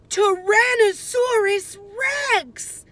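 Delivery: high, loud startled shout of the dinosaur's name; alarmed cry. A narrator's reading. Boy spotting the dinosaur, a startled high cry. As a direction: gasp it out as the monster appears. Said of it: "said Jack".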